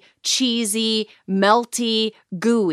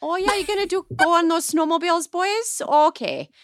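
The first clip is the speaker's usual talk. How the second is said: put-on high, singsong Minnesota accent; 8 semitones higher, faster than usual. Chatting to the boys about snowmobiles in a playful Minnesota accent. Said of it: "my Minnesota or my Southern accent".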